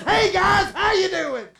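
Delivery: high, shouted hello; exuberant greeting. Acted. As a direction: burst in with a loud, comic greeting. Jesus greets the hiding disciples.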